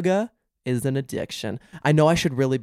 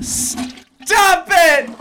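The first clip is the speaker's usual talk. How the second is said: much higher, much louder playful shriek; squealed stop; 8 dB louder than usual. Real, not acted. Host squeals at her friend's boyfriend talk, high and loud. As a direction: shriek it in giddy delight.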